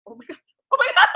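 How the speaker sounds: fast, high laughing panic. Retold or imitated. Guest laughing in panic at the relatives. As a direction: gabble it out, laughing.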